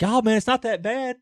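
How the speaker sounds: lightly acted coaxing, pitched high; a hint of a pleading salesman; coaxing buddy. Voicing dealer friend coaxing them.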